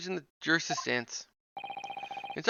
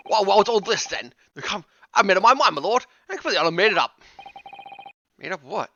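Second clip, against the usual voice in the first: grumbling Cockney working man; hint of a Cockney accent, blustering; Cockney juror. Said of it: "It's a Cockney voice".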